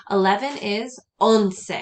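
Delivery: "once" spoken slowly and clearly as Spanish on-seh; slow and clear for note-taking; slow model. Shown as a demonstration. Teacher models eleven for students, slow and clear. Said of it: "start writing it phonetically".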